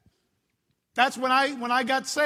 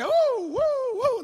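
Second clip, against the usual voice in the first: high, loud mock whooping; excited kids cheering on a trip; 12 semitones higher, 4 dB louder, slower than usual. Everyone cheering on trips.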